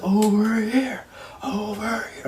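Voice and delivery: high, drawn-out "over here" holler; mock crowd calling. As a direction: imitate eager fans hailing the wrestler. Crowd calls to wrestler.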